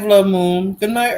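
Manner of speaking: normal talking voice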